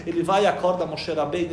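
normal talking voice

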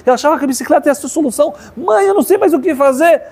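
retold boy at the end of his tether; desperate outburst. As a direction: plead in a high, agitated run, barely pausing. Retold boy's desperate outburst to mother.

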